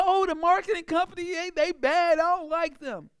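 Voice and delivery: whiny complaining. Retold or imitated. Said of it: "you say".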